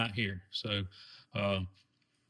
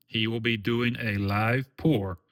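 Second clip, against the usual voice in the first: usual speaking voice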